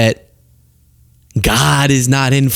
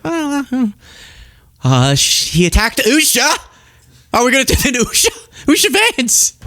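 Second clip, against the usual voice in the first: high, flustered mock dodge; mocking Tucker; 11 semitones higher than usual. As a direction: squeak out a flustered excuse. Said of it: "Tucker's like".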